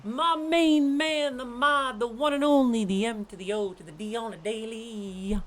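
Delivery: high, loud hype-man delivery; hype shout-out. Acted. Shouting out the mod, hype-man style.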